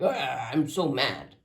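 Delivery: slow, hammed-up mad grumble; mock anger. Tarot reader mocking being mad, slow hammed-up grumble. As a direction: drag it out like a pretend tantrum. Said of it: "Not because I'm like".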